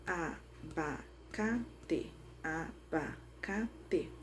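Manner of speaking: "abacate" very slow and soft, broken into a-ba-ca-te; syllable by syllable. Shown as a demonstration. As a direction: count out "abacate" in four separate beats. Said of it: "bati palma quatro vezes". ("abacate" bem devagar, separando as sílabas)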